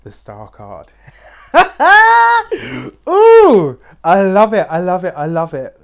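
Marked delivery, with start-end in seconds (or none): 1.1-3.0 high squeal of joy; shrieking happy laugh; delighted squealing laugh. Real, not acted. Delighted at drawing Star card. 3.1-5.8 loud, high delighted gush; real delight, loud and high; thrilled. Real, not acted. Delighted at drawing Star card.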